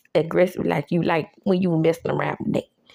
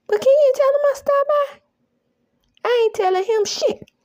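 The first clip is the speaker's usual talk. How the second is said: put-on much higher, louder girly plea; high mock woman's voice; 4 dB louder than usual. Recapping the show, she voices Belinda pleading with Tyrone: high and girly. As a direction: chirp it out like a pushy girl.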